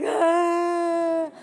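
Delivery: long, high, loud wail; mock crying wail. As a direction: imitate a woman bursting into a drawn-out sob. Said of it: "Starts crying".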